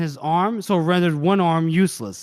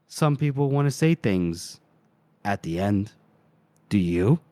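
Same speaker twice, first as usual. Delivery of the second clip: ominous last question; 8 semitones lower than usual. Acted. Killer offering his victim last words, low ominous voice. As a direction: drop deep on the final question.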